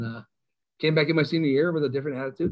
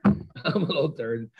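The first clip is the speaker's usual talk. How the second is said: laughing at himself; slower than usual. Real, not acted.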